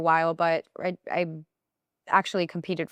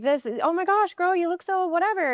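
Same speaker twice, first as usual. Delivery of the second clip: high, gushing mock friend voice; gushing girlfriend; 12 semitones higher than usual. Friend paying a compliment, gushing high mock voice. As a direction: gush over her look in a high voice. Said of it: "people would be like".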